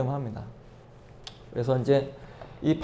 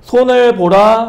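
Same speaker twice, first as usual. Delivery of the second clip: slow, louder and higher on 손을 보라; emphatic reading; 7 semitones higher, 9 dB louder, slower than usual. Shown as a demonstration. Pastor to class, revealing the name's meaning, slow and emphatic. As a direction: lean hard on 손을 보라, spelling out the name's meaning. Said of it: "그래서".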